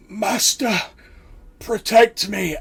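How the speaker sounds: high, loud, frantic cry for help; a dying servant begs his master; desperate plea. Acted. Dying cultist cries out to his master, panicked. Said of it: "he's like".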